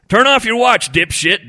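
loud, high-pitched gruff order; demanding. A narrator's reading. Fishing buddy orders Ed to silence his watch, demanding. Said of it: "Donnie demanded".

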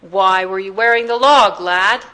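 loud circus-master question; booming voice. A narrator's reading. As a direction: boom the question at the boy. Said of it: "He was used to Astley's boom".

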